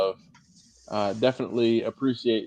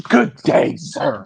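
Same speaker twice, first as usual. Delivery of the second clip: Susie huff; 6 dB louder, slower than usual. Retold or imitated. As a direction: snap the good day out like a dismissal. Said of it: "Peaches said".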